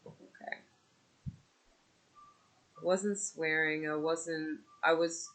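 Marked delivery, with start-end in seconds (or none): 0.1-0.6 breathy unvoiced disbelief; stunned whisper. Mother answering the rude clerk, whispered disbelief. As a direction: whisper it, taken aback. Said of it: "I'm like".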